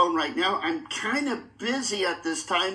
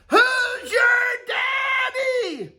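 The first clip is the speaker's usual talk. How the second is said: high, slow, drawn-out victory yell; triumphant yell; 6 dB louder, much slower than usual. Pretend. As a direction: roar it like a champion. Triumphant champion call.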